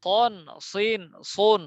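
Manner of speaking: সন, সিন, সুন with a thick rounded s; heavy syllables. Shown as a demonstration. Teacher drilling repeat-after-me, heavy letters. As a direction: keep সন, সিন, সুন full-mouthed, back of tongue up. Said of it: "সিনের মতো যেন না হয়".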